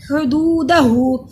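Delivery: हुदूदहू with long दू and final हू; very slow measured recitation; lengthened doo. Shown as a demonstration. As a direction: stretch the oo sounds in हुदूदहू. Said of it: "दू को लंबा करेंगे".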